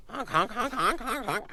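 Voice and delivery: honking like a goose; goose honks. Retold or imitated. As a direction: honk like a goose.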